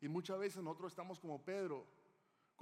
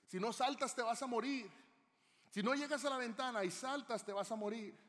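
urgent yell, much higher and louder; firefighter shouting; 8 semitones higher, 6 dB louder than usual. Retold or imitated. Firefighter yelling to a blind girl trapped by fire, urgent shout. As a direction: shout up to a girl in a burning building. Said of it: "escuchó la voz de un bombero gritar".

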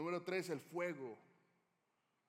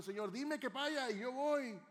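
higher, louder and slow, full of bold zeal; eager believer; 9 semitones higher, 6 dB louder, slower than usual. Acted. A believer telling the Lord he is ready to go, eager and bold. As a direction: announce your faith with eager confidence.

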